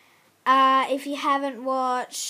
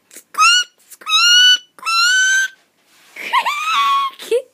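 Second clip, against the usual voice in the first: much higher, loud piercing squeaks; tiny bird squeak; 13 dB louder, much slower than usual. Pretend. Kid showing a toy bird, loud very high squeaks.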